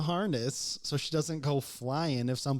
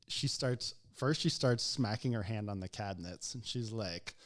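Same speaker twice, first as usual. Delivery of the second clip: ordinary delivery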